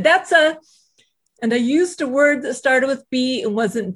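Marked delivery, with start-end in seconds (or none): 0.0-0.6 angry retort. Retold or imitated. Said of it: "And I said".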